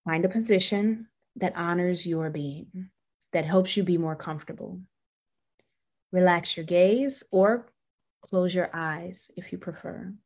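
calm, unhurried meditation guide; guided meditation. Acted. Leads a humming meditation.

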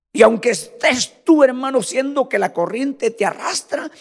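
loud, high full-force sermon delivery; fiery preaching. Acted. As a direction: preach it out to the whole church.

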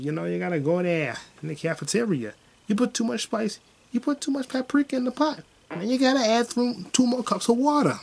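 high-pitched, hammed-up Boston accent; Da'Vine Joy Randolph's Boston accent. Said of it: "a strong Boston accent".

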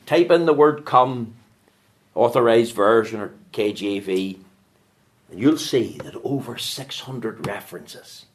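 ordinary talk

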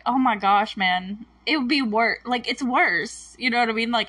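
usual speaking voice

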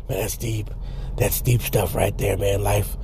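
low, hushed musing; mock profound. Podcaster marvelling at his own metaphor, mock-solemn hush. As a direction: murmur it as if awed by deep wisdom. Mock reflection after the joke.

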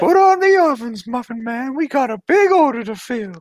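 put-on high, creepy old man voice; quavering old creep, high and thin; Herbert from Family Guy. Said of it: "you literally just sound like Herbert from Family Guy".

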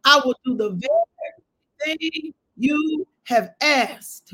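preacher voicing God's answer to Moses; fervent preached promise. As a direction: proclaim it slowly with weight.

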